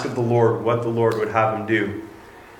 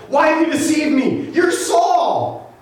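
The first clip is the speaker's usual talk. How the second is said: high-pitched, alarmed acted outcry; crying out; slower than usual. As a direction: cry out in shocked accusation, pitched way up. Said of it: "cried out at the top of her voice".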